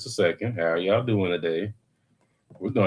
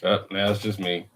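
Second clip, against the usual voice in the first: usual speaking voice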